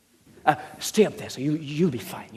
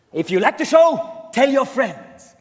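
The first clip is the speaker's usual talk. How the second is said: loud, slow showman call; showman announcement; 5 semitones higher, 5 dB louder, slower than usual. Acted. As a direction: announce it to the crowd.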